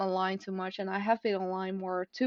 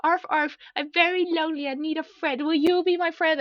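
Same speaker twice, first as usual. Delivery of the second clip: higher, louder, sweet lonely puppy voice; cartoon dog voice; 8 semitones higher, 4 dB louder than usual. Reading her sketch of a dog, higher lonely puppy voice. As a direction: play a lonely little dog begging for a friend. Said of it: "And he says".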